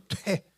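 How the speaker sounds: short imitated spit sound; mimed spitting. People spit at Jesus before the cross, with contempt. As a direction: act out spitting at someone. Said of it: "침을 막 뱉는 거예요".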